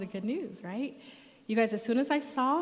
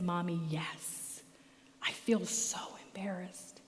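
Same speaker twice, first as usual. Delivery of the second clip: softer, slow whisper; whispered admission; 2 semitones lower, 6 dB quieter than usual. Retold or imitated. Son admitting to mom he feels embarrassed, slow whisper. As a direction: confess it in a small hushed voice.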